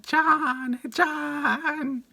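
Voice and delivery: high, held, off-key mock wail. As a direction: call out the name in a long, piercing mock yell. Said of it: "started doing that".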